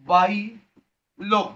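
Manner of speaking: two long, separated syllables; slow, split term. Shown as a demonstration. Teacher answering own question on the anther, slow and split. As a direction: say বাইলোব as বাই, a pause, then লোব.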